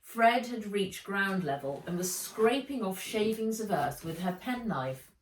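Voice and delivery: ordinary delivery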